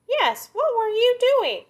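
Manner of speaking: very high, slow and drawn out; nosy little gopher; high prying gopher. Acted. Gopher questions coyote.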